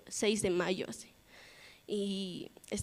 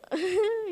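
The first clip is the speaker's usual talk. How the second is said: short high giggle; a nervous little laugh, much higher; 11 semitones higher, 3 dB louder than usual. Real, not acted. Reacting to friends' urging.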